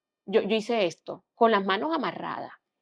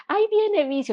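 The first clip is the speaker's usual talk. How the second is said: cheerful greeting; 12 semitones higher, 5 dB louder than usual. Retold or imitated. Surgery staff cheering her arrival.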